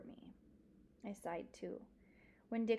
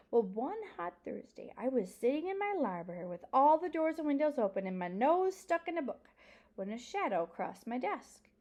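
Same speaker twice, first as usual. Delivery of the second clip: put-on animated, louder, swooping old librarian; old librarian storyteller; 6 semitones higher, 6 dB louder than usual. Old librarian recalling her know-it-all youth, lively and emphatic. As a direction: relish the tale with big pitch swings. Miss Franny tells her bear tale.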